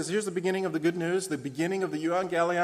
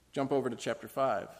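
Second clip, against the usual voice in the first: ordinary talk, nothing marked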